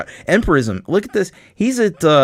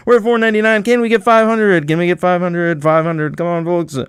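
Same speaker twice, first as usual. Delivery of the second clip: fast auction chant, rising and louder; auctioneer; 5 semitones higher, 3 dB louder than usual. Pretend. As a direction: rattle off bids like an auctioneer. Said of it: "I'm like an auctioneer here".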